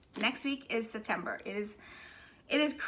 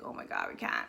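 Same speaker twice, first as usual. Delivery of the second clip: shocked whisper; 5 dB quieter, faster than usual. Retold or imitated. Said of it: "I was like".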